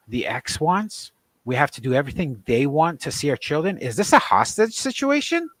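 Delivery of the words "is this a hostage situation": high, louder indignant questioning; outraged disbelief. Real, not acted. Father venting at custody rules, outraged high-pitched questioning. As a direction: demand an answer in disbelief.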